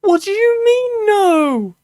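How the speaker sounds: high, loud disbelieving yell; incredulous shout. Real, not acted. Incredulous reply to "no.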